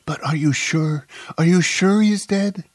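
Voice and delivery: high, loud disbelieving plea; frantic. A narrator's reading. Woman begs to know if he is surely dead, frantic. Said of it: "she asked frantically".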